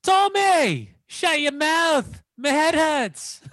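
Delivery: high, slow scolding mother voice; mother impression scolding her son, high and drawn out; mum impression. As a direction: do your mum telling Tommy off, high and slow. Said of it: "your impressions are all pretty much the same thing".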